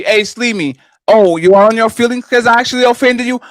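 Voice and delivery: sneering put-down; mocking taunt. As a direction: rub it in, mock his hurt feelings. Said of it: "That's the worst kind of apology".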